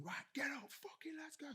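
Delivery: urgent whispered bellowing, high and strained; hushed mock shout. Proper on at the lads, swearing, in a hushed mock shout. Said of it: "I was proper on".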